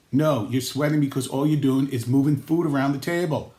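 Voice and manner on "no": ordinary talk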